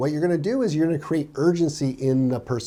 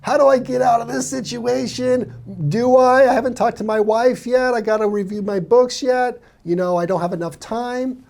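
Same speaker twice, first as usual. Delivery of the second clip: higher, louder worried client voice; imitating a hesitant client, pitched up; anxious prospect; 9 semitones higher, 4 dB louder than usual. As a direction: voice a nervous buyer looking for an exit. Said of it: "the person on the other end is thinking".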